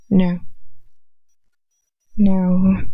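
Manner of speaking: ordinary delivery, nothing marked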